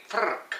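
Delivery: frrrk with a rolled Scottish R, high. Shown as a demonstration. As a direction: say frrrk like a Scot would, R trilled. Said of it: "Like a little bit Scottish".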